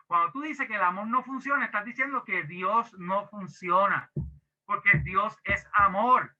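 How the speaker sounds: ordinary talk, nothing marked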